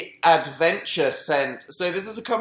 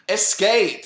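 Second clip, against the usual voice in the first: dramatic; 5 semitones higher, 4 dB louder than usual. Pretend. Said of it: "that's quite creepy to me".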